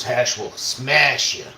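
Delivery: slow, drawn-out menacing slogan. Pretend. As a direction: drag out the threat.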